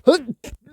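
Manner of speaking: sudden loud sneeze, high burst; real sneeze. Real, not acted. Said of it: "now I'm about to sneeze".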